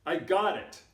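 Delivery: loud, high burst; excited realisation. Retold or imitated. Nate tells Jared he has the answer, excited and loud. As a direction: blurt out a sudden idea. Friend's sudden idea acted out.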